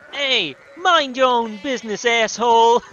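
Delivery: loud, high-pitched New York shout; New York accent. Acted. Young Martin telling someone off, loud New York accent. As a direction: snap back angrily like a loud New Yorker. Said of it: "I chose a New York accent".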